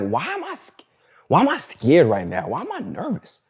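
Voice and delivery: high-pitched, mildly alarmed self-questioning; retold nervous bafflement. Man recalling his own fear at neighbors yelling, retold nervous bafflement. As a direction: voice jumps up high in baffled worry. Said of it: "I'm feeling scared. I'm like".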